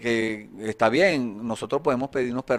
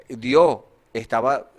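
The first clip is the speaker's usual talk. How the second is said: ordinary delivery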